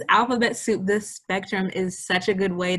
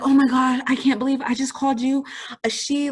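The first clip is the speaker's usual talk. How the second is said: louder, higher, fast gush of panic; flustered over-apology; 5 semitones higher, 4 dB louder than usual. Retold or imitated. Guilty friend after misgendering a loved one, flustered and gushing. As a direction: overdo the guilt in a rush. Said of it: "I'm like".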